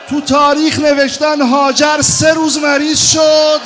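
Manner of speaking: loud, high lament preached at full force; wailing mourning sermon. Acted. Preacher wailing Hajar's grief to the mourners, loud and high. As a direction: cry the story out to the mourners.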